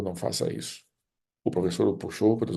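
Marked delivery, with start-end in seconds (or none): 0.0-0.8 breathless whispered begging; whispered plea. Acted. Boy whispering to the teacher, begging him to stop.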